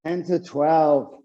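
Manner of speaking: normal talking voice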